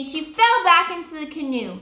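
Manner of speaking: usual speaking voice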